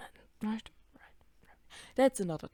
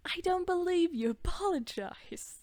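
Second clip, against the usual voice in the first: high, insistent acted demand; mock confrontation; 10 semitones higher than usual. Big sister confronting her sister's bullies, mock-indignant and high. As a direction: play the indignant protector, lightly hammed up.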